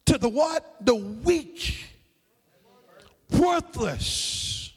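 slow, word-by-word preaching with long pauses; emphatic, drawn-out recitation; emphatic scripture reading. Acted. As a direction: drive each phrase home, stretched and separated. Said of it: "It's an emphatic in the Greek".

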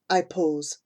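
plain reading voice

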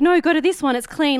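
loud, high-pitched imitation of a cafe owner; bossy cafe owner. Cafe owner redirecting customers to another seat, comically bossy.

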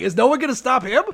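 exaggerated incredulous plea; mock outcry. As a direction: appeal to the whole crowd in disbelief. Acted onlookers protesting, retold.

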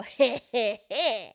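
gloating villain chuckle; evil chuckle. Retold or imitated. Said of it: "we like to go".

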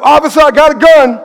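high, loud laughing blurt. Retold or imitated. Retelling friend blurting to the officer, high and loud. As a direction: shout it up the street, half laughing. Retold friend's warning again.

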